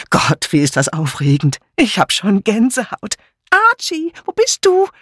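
thrilled party guests, climbing to a high squeal; excited exclamations. A narrator's reading. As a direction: build from excited remark to high-pitched call. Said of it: "Andere riefen".

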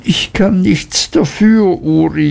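wailing mother. A narrator's reading. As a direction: lament that you can't help it. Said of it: "jammerte die Mutter".

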